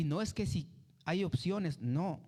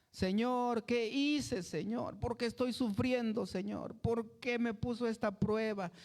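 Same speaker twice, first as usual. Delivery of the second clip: lamento agudo imitado, casi llorando; queja llorosa; 8 semitones higher than usual. Retold or imitated. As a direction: finge el llanto del creyente que sufre. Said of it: "uno está llorando". (creyente que llora ante Dios, lamento agudo imitado)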